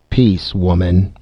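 usual speaking voice

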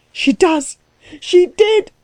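very high, frantic insistence; hysterical mock scream. A narrator's reading. Klutz beside himself contradicting Axel, high contained scream. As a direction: shriek it in pitch without much volume. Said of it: "screamed Klutz, beside himself".

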